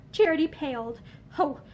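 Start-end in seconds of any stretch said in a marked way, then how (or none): none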